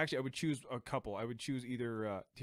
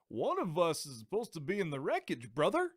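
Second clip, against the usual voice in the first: higher-pitched, botched supervillain voice; horrible Bane; 5 semitones higher, 4 dB louder than usual. Retold or imitated. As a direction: badly imitate a masked villain. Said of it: "it literally sounded like this".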